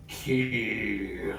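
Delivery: low rumbling demonic rasp; deep rumbling voice. Acted. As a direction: rumble it out like a huge creature. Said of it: "in a deep rumbling voice in my broken Undercommon".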